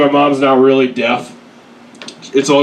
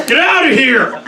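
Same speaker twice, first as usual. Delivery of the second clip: imitating a fed-up cop, pitched up; exasperated cop; 9 semitones higher than usual. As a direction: wave them off high and impatient. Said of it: "He's like".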